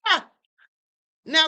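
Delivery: ordinary delivery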